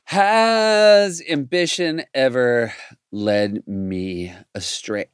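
slow, drawn-out repeat of the question; pondering echo. Real, not acted. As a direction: mull it over aloud, word by word. Pondering the question just asked.

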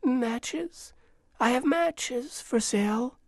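small, high, weakened street call; shriveled voice. A narrator's reading. Worn-out girl offering matches for sale, small and shriveled. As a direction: plead in a tiny high voice, lifting at the end. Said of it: "her voice had shriveled".

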